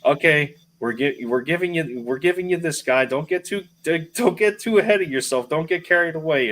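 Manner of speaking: Bears front office. Retold or imitated. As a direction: talk down to Howie like a cautious GM. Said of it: "it's like the Bears telling Howie".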